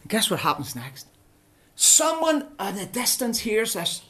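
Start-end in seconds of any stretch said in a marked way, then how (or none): none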